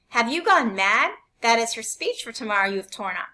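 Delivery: mother outraged at the torn speech. A narrator's reading. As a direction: demand an answer. Mother shouting at father.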